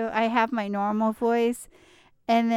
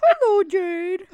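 slow, high, loud puppet greeting; 9 semitones higher, 8 dB louder, slower than usual. Shown as a demonstration. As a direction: greet like a squeaky talking animal. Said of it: "You're not that high when you do it".

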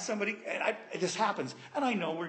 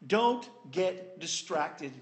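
slow warning; much slower than usual. Real, not acted. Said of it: "slow emphatic warning".

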